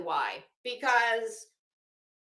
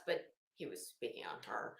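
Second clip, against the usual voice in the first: much softer, whispered side note; whispered aside; 15 dB quieter than usual. Real, not acted. Whispering a quick clarification to viewers as an aside. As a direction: slip it in under the breath.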